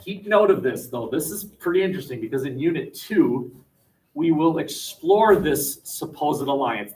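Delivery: normal talking voice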